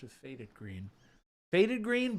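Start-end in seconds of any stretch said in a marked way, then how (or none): 1.5-2.2 excited. Real, not acted. Enthusing over paint.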